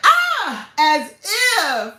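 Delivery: high, loud mock-scoffing quote; squeal, then toss out the line high; movie character impression. Streamer imitating a movie character, high loud exclamation. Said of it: "She was like".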